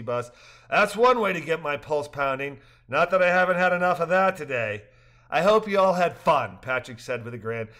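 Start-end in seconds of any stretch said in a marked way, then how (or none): 0.7-6.6 higher, louder lively dialogue; cheerful characters. A narrator's reading. Rafters joking after the trip, higher and louder. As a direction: voice the rafters brightly.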